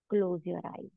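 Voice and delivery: usual speaking voice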